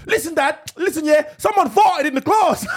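put-on high, loud excited kid; son's voice. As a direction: blurt it out like a kid. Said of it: "he's doing a voice".

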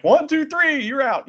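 referee count. Retold or imitated. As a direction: call the count like a ref. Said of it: "And the ref's like".

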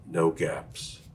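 ordinary delivery

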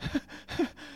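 sharp unvoiced high gasp; breathless gasp. Boy finally firing the ray gun in church, gasping. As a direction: suck in air as you give in. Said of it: "And then I just went".

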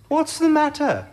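Alice voice. A narrator's reading. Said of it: "asked Alice".

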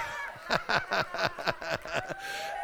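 hearty demonstrative laugh; demo laughter. Shown as a demonstration. Pastor playing laughter leader, hearty laugh. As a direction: show them how the leader laughs. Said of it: "start laughing".

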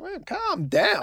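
high, slow, mocking "calm down".

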